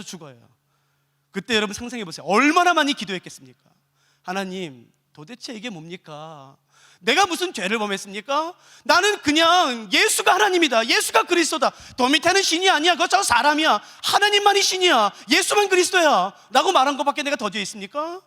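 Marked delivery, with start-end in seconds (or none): 4.2-6.6 low, hushed, slow prayer; earnest plea. Acted. Persecuted believer questioning God, softly pleading. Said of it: "수많은 간절한 호소들이에요". 7.0-18.2 rising, insistent cry of innocence; earnest plea. Acted. Persecuted believer protesting innocence to God, pleading. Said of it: "수많은 간절한 호소들이에요".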